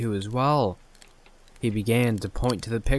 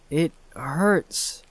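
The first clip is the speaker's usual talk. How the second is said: tearful; 5 semitones higher than usual. Acted. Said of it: "tears in his eyes".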